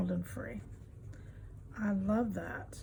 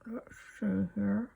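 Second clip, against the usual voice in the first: pins in mouth. Real, not acted. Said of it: "Got a pin in my mouth".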